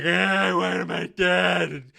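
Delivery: mock dying groan, drawn out; Adar. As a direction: stretch it into a comically pitiful death line. Dying Adar voiced in mockery.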